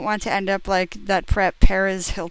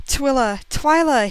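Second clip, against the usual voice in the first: dramatic call; 6 semitones higher, 4 dB louder than usual. Acted. Breathless dramatic call in story.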